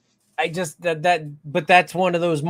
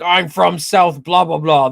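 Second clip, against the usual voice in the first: put-on loud, drawn-out Southern accent; retold Southern accent, slow and loud; 6 dB louder, slower than usual. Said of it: "say it in a Southern accent".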